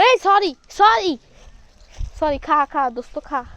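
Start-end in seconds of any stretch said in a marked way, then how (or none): 0.0-1.2 high, loud startled apology; sudden loud sorry. Real, not acted. Shouting apology at goat. 2.2-2.9 loud coaxing. Real, not acted. Coaxing goat loudly.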